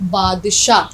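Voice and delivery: normal talking voice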